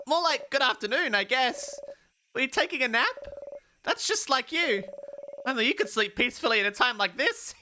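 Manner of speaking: high-voiced, gently teasing companion; perky game companion. Companion waking a napping friend before the big battle, high and teasing. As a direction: wake a friend in a high, chirpy character voice.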